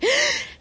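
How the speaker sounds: sharp high gasp of alarm; mock gasp. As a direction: act out a sudden gasp of worry. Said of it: "Yo ahí como que". (jadeo de susto fingido)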